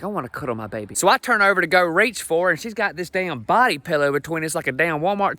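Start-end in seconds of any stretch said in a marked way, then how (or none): 0.0-0.9 soft sleepy wish. Retold or imitated. Sleepy husband wanting cuddles.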